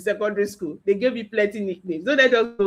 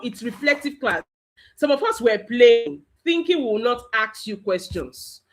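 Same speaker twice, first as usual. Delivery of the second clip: ordinary delivery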